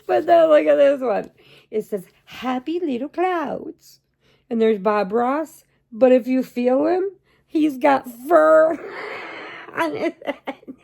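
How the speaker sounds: high, loud words through real laughter; can barely get it out for laughing; laughing hard. Real, not acted. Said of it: "I laughed so hard at that".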